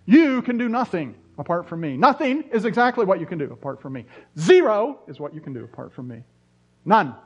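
loud, pounding stress on "nothing", "Zero" and "None"; emphatic. Shown as a demonstration. Preacher mocking the harsh reading he grew up with, loud and emphatic. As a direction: drive each word down like a hammer blow. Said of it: "so loud and so emphatic".

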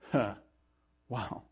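usual speaking voice

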